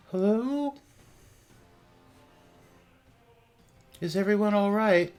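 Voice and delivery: high, airy ghost voice; ghostly voice. Acted. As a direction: call out like a lost spirit. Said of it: "You hear a ghostly voice".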